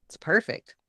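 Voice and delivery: ordinary talk, nothing marked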